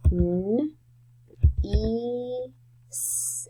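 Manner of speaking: "N, e, s" one sound at a time, "s" whispered. Shown as a demonstration. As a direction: spell "N, e, s" out in separate sounds. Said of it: "the first sound n".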